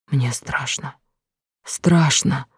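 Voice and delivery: whispered terrified plea; frightened whisper. Acted. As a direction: beg in a scared whisper. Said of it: "взмолилась".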